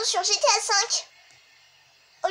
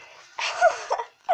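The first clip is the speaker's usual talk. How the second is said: higher giggle; laughing; 4 semitones higher than usual. Real, not acted. Friend amused as the police lose them, laughing.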